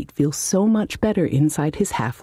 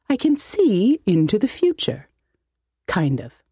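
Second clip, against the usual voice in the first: boastful wizard; 6 semitones higher than usual. Acted. Wizard boasts, then hedges.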